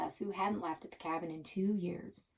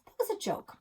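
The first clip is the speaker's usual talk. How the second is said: backpedaling; 5 semitones higher, 8 dB louder than usual. Acted. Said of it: "I tried to unsay it".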